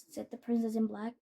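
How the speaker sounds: plain reading voice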